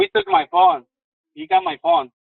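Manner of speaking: high, urgent panicked voice. Real, not acted. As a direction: blurt it out in panic. Panicked caller during robbery.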